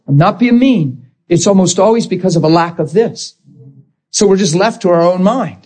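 ordinary talk

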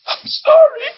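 very high, sobbing mock wail; retelling a wife's hysterical apology, high and sobbing; imitating bawling. Wife running bawling hysterically.

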